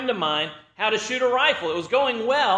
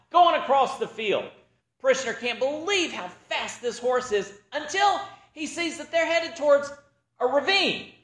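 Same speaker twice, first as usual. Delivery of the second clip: normal talking voice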